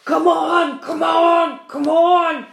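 loud, high, hammering repeats; impatient shout. A narrator's reading. Centipede shouting impatiently at the glowworm. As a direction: bang out each come on. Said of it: "shouted the centipede".

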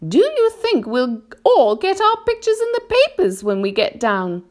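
high-pitched, eager ladybird question; ladybird asking. A narrator's reading. Ladybird asks friends about pictures in the papers, high questioning voice. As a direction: ask in a high little character voice. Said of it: "the ladybird asked".

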